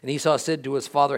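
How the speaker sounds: ordinary talk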